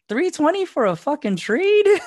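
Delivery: high, loud disbelief; outraged at the price, voice shooting up; incredulous. Retold or imitated.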